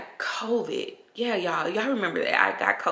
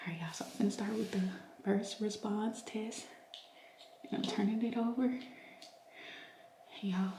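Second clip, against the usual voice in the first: whispered, tense anticipation; hushed whisper; 2 semitones higher, 12 dB quieter than usual. Real, not acted. Whispering to viewers while flipping the test over. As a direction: keep it secret and breathless.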